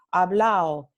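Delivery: "hablao" punched out with no D; dropped D. Shown as a demonstration. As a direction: run the final vowels of "hablao" together as a-o. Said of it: "la D intervocálica se elide".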